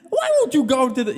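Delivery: put-on childish high Adam Sandler voice, loud. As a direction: talk like a four-year-old child. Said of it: "Kinda talks like a four-year-old child".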